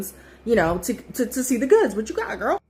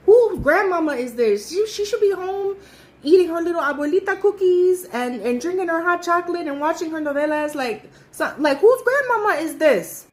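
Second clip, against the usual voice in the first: mock exclaiming; 8 semitones higher, 4 dB louder than usual. Worried mocking about old dancer.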